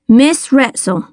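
ordinary delivery

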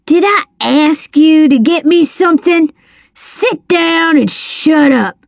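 high, bullying put-down; angry rebuke. A narrator's reading. Drunken man silencing the child, angrily.

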